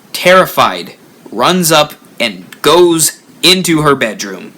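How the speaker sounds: ordinary delivery, nothing marked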